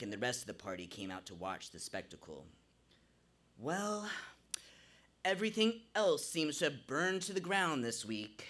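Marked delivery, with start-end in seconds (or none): 3.6-8.4 exclaiming. A narrator's reading. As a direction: call it out with exasperated flair. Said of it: "Zach exclaimed".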